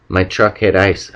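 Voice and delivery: plain reading voice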